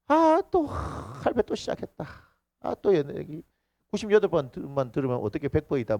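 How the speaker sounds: a fed-up, dragging moan; bored complaining brother.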